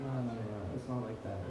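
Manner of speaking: low, hushed placating; meek protest. Retold or imitated. Grandson excusing himself to his grandfather, meek low protest. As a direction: back down softly, pitched low.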